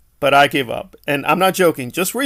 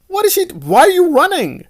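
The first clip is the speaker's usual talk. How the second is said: high, loud incredulous question; mock disbelief; 13 semitones higher, 5 dB louder than usual. Reviewer mocking a character who runs from nothing, high and loud.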